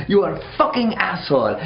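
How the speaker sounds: put-on escalating French-accented rant, second take. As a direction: hit "fucking" harder on the repeat. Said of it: "it's like a French dude".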